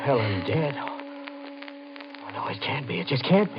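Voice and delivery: soft, broken denial; he cannot take it in; stunned grief. Acted. Husband learns his wife died, soft broken denial.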